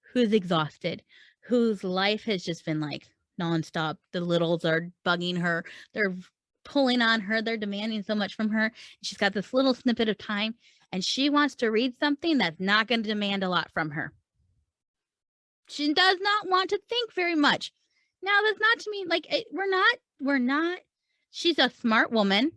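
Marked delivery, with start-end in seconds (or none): none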